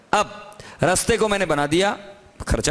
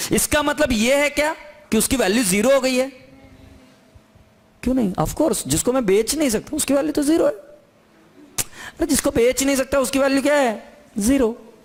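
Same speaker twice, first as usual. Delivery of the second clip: excited; 6 semitones higher than usual. Real, not acted. Teacher building to an excited climax.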